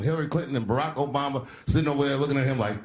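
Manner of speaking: normal talking voice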